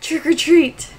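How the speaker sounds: "or" in "Trick or treat" shrunk to a quick "er"; reduced "or". Shown as a demonstration. Host announcing the movie title, "or" reduced to 'r. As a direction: run "Trick or treat" together as "trick-er-treat". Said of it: "Not trick or treat. Trick or".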